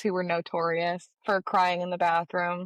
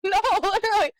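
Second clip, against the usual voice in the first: ordinary talk, nothing marked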